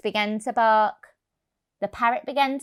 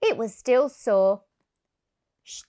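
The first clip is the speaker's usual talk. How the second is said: plain reading voice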